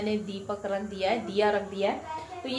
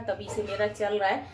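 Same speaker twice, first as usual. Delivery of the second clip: normal talking voice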